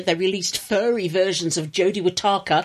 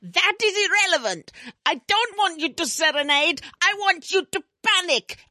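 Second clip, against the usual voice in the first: urgent, higher alarmist rant; 7 semitones higher than usual. Acted. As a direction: whip up panic like a doom prophet. Greta parody panic rant.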